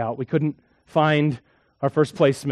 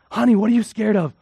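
put-on higher, louder parent asking a child; lightly acted parent's question, pitched up; 7 semitones higher, 5 dB louder than usual.

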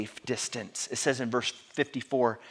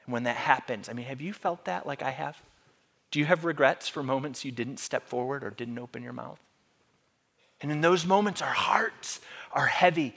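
usual speaking voice

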